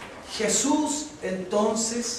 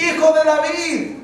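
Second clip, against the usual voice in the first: loud, high, slow call; crying out; 9 semitones higher, 9 dB louder, slower than usual. Retold or imitated. Blind man calls to the Son of David, crying out. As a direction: call out slowly at raised volume. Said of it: "Él da voces, él grita".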